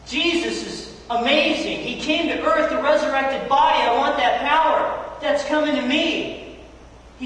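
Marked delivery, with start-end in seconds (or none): none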